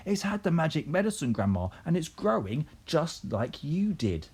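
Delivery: put-on high, eager boy's voice; excited shouting. A narrator's reading. George shouting to Grandma about the hen, high excitement. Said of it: "pointing at the enormous hen and shouting".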